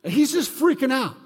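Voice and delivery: normal talking voice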